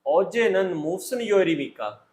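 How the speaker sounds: usual speaking voice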